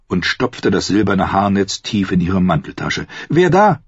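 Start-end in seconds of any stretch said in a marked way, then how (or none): 3.3-3.8 high, loud call into the dark. Acted. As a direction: call out, startled. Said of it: "rief sie". (laut und hoch ins Dunkel gerufen)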